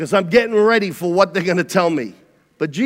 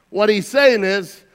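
ordinary delivery

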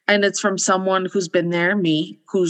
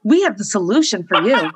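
infomercial; 6 semitones higher than usual. Pretend. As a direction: hype it like a late-night ad. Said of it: "Do I sound like an infomercial?".

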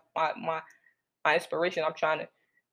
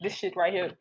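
ordinary talk

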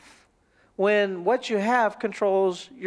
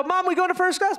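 high, eager child imitation; little girl; 7 semitones higher than usual. Little girl asking her mom, eager. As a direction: ask like an excited small kid.

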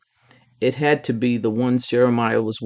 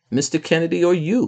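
ordinary delivery

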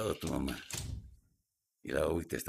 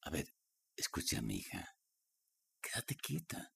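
menacing whisper; 8 semitones lower, 8 dB quieter than usual. Acted. Stepfather whispering to the girl to keep still. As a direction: hiss it so nobody hears.

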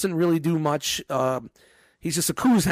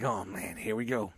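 much softer resigned sigh of a line; weary aside; 7 semitones lower, 11 dB quieter than usual. Retold or imitated. Boss recalls his tired reaction to the kid, softly resigned. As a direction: mutter it like you've seen this before. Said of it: "And I'm like".

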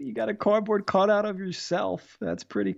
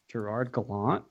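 put-on name said in a French accent, lower and softer; 8 semitones lower, 5 dB quieter than usual. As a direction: Gerard Gallant pronounced the French way. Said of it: "Trying to speak French".

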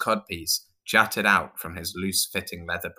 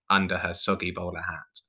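usual speaking voice

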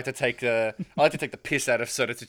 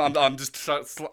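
ordinary talk

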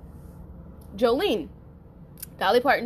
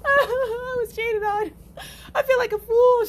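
high, wailing mock sob; hammed-up tearful wail; mock sobbing; 13 semitones higher, 4 dB louder than usual. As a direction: whine it like crying over being cheated. Retold crying to mom.

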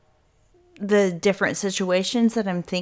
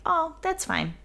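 mock agreeable; 8 semitones higher, 4 dB quieter than usual. Pretends to accept waiting.

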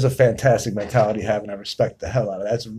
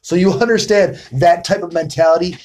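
excited; 6 semitones higher, 6 dB louder than usual. Real, not acted. Excited praise of her answer.

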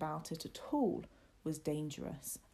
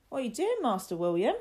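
lightly voiced servant boy, higher and louder; quick, loud question, pitched up; Martin's questioning call; 6 semitones higher, 7 dB louder, faster than usual. A narrator's reading. Martin calls to William by lake.

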